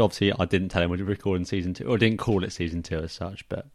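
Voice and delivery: ordinary delivery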